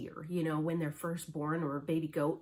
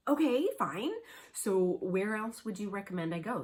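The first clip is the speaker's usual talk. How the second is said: exasperated; 4 semitones higher, 5 dB louder than usual. Retold or imitated. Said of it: "And I'm like".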